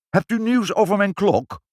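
high, loud eager question; surprised cry. A narrator's reading. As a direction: call out in sudden hope. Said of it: "Verrast stond de baron op en riep".

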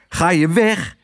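high, sharp shooing; indignant call to scare it away; scolding call. A narrator's reading. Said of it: "riep ze tegen de kraai".

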